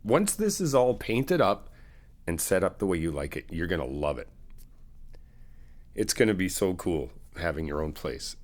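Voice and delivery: usual speaking voice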